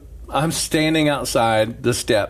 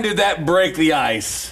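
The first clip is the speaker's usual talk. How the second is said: usual speaking voice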